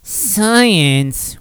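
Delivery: badly faked, hammed-up sour commenter; shitty person. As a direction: sneer it as an obnoxious complainer. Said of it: "this is gonna be a shitty person".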